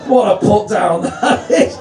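ordinary talk, nothing marked

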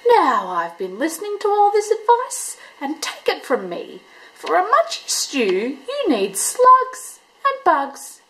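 fussy echidna giving advice; know-it-all Echidna. A narrator's reading. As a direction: lecture the others. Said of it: "he bristled".

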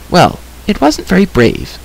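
put-on high-pitched girl's voice; frank girl. A narrator's reading. Girl weighing the creature's flight, frank.

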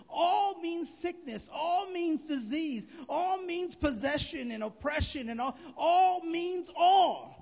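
slow, high and loud, building to a roar; building preaching climax. Acted. Preacher building to a shouted climax for the congregation.

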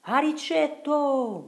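long, very high and loud hail; calling out across water. Acted. Boat companions calling to Riccetto near the pillar, long shout. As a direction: call to someone far off on the river. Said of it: "gridarono i compagni della barca".

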